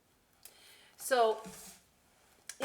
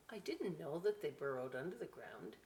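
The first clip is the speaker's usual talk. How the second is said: usual speaking voice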